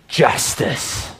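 breathy whispered justice; whispered gloat. Pretend. Preacher imagining his own satisfaction, a hushed whisper.

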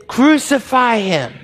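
slow, loud, heavy chant; the crowd demanding death from Pilate, slow and loud; crowd shout. Acted. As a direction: bellow it like an angry mob.

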